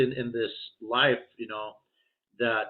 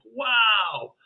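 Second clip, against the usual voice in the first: amazed wow; 10 semitones higher, 4 dB louder than usual. Retold or imitated. Amazed at the guide.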